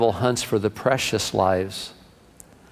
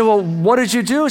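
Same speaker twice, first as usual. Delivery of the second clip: higher, mock-exasperated question; challenging husband; 6 semitones higher than usual. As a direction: press her like a husband in a joke. Husband challenges wife's excuse.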